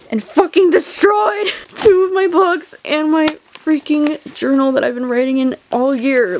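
loud, high, furious shout over the ruined books; real fury at the dog; angry outburst. Real, not acted. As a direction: yell it, outraged and half laughing. Dog destroyed her books.

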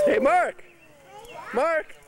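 bright high call. Real, not acted. Calling out to toddler.

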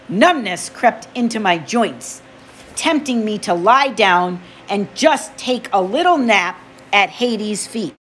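plain reading voice

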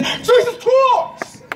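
high, drawn-out cry; sudden piercing call for help; demonstrated scream. Shown as a demonstration. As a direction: scream the name long and high. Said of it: "this is what God told me to do".

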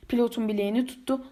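ordinary delivery, nothing marked

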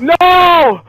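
high, pained exclamation; dismayed cry. Real, not acted. Player so close to winning, dismayed cry. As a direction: cry out at a near miss.

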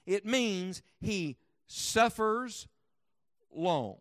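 "suffers" drawn out very slowly, pause, then "long"; teaching the meaning by splitting the word; stress on suffers long. Shown as a demonstration. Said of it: "chop it in half, and flip it around".

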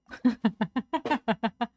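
rapid run of giggles. Real, not acted. Streamer giving up on the pronunciation, rapid giggling. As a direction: dissolve into quick laughter. Gives up on pronouncing the word.

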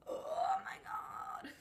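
high unvoiced disbelieving cry; strangled cry. Real, not acted. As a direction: squeal out your despair. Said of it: "What are you doing?".

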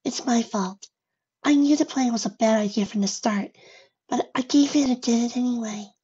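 high, small timid pony voice; unsteady. A narrator's reading. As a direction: confess it meekly, high and unsteady. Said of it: "said in an unsteady manner".

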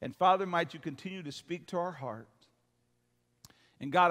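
ordinary delivery

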